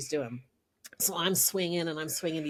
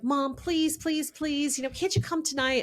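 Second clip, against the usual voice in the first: higher-pitched imitation of a begging daughter; pleading child; 8 semitones higher than usual. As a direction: beg mom like an eager kid. Daughter pleading with her mother.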